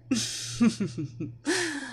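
soft villain's chuckle; quiet laugh of triumph; gloating laugh. A narrator's reading. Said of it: "Evelina laughed".

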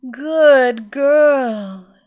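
loud, drawn-out praise; praising mistress. Acted. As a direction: reward her like a proud mistress. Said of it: "Evelina murmured".

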